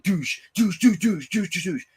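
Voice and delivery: high repeated mock sound effect; imitated slamming noise. Acting out Michael slamming Cameron's head, mock sound effect. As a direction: hammer out each douche like a hit.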